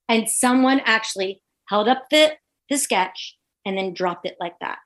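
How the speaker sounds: usual speaking voice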